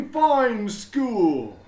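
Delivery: high, loud and slow, booming with pride; a beaming principal proclaiming to everyone; proud headmaster announcing. A narrator's reading. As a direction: announce it grandly to the whole school. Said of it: "he said".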